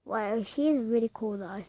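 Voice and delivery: ordinary talk, nothing marked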